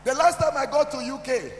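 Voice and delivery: preaching at full force. Real, not acted.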